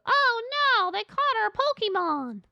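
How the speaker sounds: high, loud cartoonish wail; mock alarm. Friend sees the alien netted, high mock alarm. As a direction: cry out in hammed-up dismay. Acts alarm as net drops.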